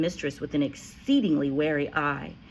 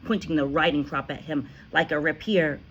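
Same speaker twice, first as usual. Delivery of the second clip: ordinary delivery, nothing marked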